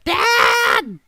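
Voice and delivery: high, loud, long call; boy's shout. Acted. Boy running to back door, shouting for Dad. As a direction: holler for Dad like a kid. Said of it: "you hear him shout".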